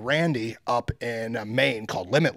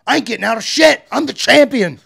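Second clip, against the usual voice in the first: much higher, loud defiant yell; defiant shout; 10 semitones higher, 11 dB louder than usual. Retold or imitated. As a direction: shout him down like the champion. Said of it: "And I was like".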